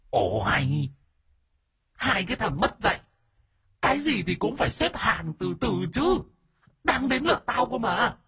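a possessed ghost pushing for its turn; high wheedling ghost voice; indignant ghost voice. Acted. Ghost possessing the wife protests.

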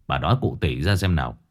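low and brisk, spell it out; husband voice. Acted. Old husband presses his wife for details, low and quick.

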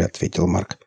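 ordinary delivery, nothing marked

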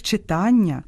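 «читання» stressed on ТА; correct stress. Shown as a demonstration. Host naming the reading lesson properly, middle-syllable stress. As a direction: land on ТА in «читання». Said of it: "перетворюється на урок якогось".

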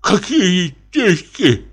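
slow, puzzled question; the old captain asks what money, baffled; bewildered. A narrator's reading. Bewildered, slow question. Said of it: "в недоумении".

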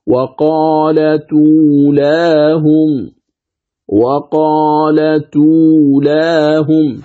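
"وقالت أولاهم" very slow as wa-qaala-tuu-laa-hum; chant-like model, t linked into uu with no hamza; correct linked reading. Shown as a demonstration. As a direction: stretch each long vowel on a level pitch. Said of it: "إذن تصبح الكلمة".

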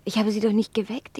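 normal talking voice

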